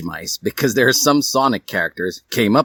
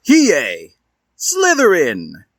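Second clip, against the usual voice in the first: slow loud announcement; 8 semitones higher, 5 dB louder, much slower than usual. Acted. Sorting hat announces.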